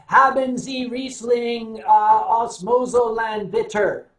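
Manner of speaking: put-on German ordered slowly and loudly with a thick American accent; a clumsy American tourist ordering wine in German. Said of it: "with my thick American accent and said".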